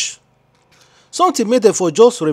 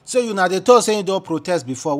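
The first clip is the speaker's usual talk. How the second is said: ordinary talk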